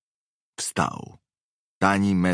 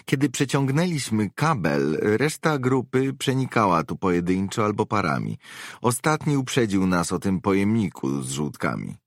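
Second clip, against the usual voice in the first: higher-pitched woman's character voice; woman mercenary reporting; 6 semitones higher than usual. Acted. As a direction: report to the boss in a raised, feminine register. Webber continues her report.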